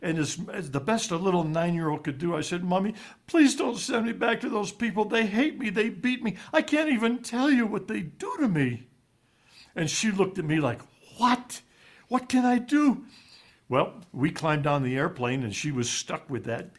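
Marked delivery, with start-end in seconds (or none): 2.6-8.9 put-on a higher, pleading little boy's voice; child pleading. Boy begs his mother not to send him back, pleading. 11.2-13.0 higher, helpless mom voice, lightly voiced; helpless mother. Mother answers her son's plea, helpless.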